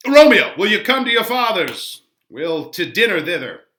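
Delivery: loud call across the street, high at the start; shouted invitation. Acted. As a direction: summon a friend to dinner. Benvolio calling Romeo.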